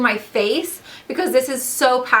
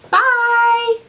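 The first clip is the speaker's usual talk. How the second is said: excited bye; 12 semitones higher, 5 dB louder than usual. Real, not acted. Cheery sign-off to viewers.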